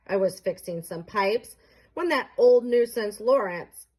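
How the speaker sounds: ordinary delivery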